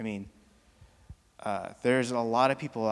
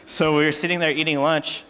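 louder, higher restart over the crowd; retelling the scene louder after the shout out; 5 semitones higher, 10 dB louder than usual. Real, not acted. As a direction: lift it back over the room.

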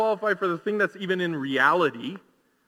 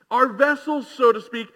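usual speaking voice

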